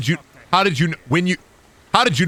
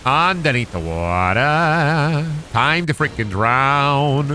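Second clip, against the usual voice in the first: slow, drawn-out words; slow drawl; much slower than usual. Acted. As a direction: stretch every word out. Drawling at the game.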